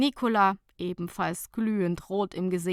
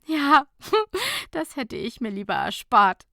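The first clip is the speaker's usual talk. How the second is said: laughing admission; 6 semitones higher, 6 dB louder than usual. A narrator's reading. Laughing confession.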